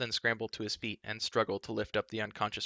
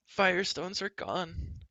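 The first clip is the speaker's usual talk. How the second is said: higher, slow, wailing creature; slow moan; 9 semitones higher, slower than usual. A narrator's reading. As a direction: moan out the loss. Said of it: "Gurgi moaned".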